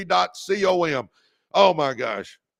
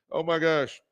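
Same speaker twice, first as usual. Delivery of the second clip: ordinary delivery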